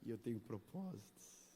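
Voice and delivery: very soft, low murmur, slowing at the end; God speaking intimately. Acted. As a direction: speak as a father close at the ear. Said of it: "eu consigo ouvir Deus falar". (voz de Deus, baixinha e íntima)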